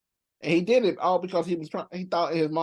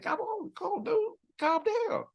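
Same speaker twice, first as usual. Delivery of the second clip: high pleading voice. Retold or imitated. Acts out someone pleading to calm down.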